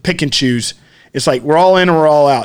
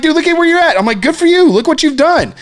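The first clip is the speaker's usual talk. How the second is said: sarcastic praise; 11 semitones higher than usual. Retold or imitated. Ranting to friend about the driver, high sarcastic praise. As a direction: gush with fake admiration.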